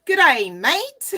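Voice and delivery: a slow, loud, high stab at an Australian greeting; mock Australian accent. Greeting a viewer from Australia, mock Australian accent. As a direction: playfully try an Australian "good day, mate". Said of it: "Is that how?".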